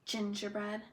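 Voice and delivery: ordinary delivery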